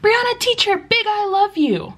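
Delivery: high-pitched little pupil voice; put-on high kid voice; imitating young student. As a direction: mimic a small student calling to teacher. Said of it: "students would say this rather frequently".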